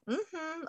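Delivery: high "mm-hmm" of yes; a light, high hum of assent; gut yes hum. Retold or imitated. Host voicing a sacral yes, high hum. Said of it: "It's just gonna be like".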